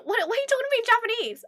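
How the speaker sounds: high, rapid baffled protest; incredulous. Retold or imitated. Streamer replays own reply to a man speaking Japanese, incredulous. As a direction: blurt it out high and fast, exasperated.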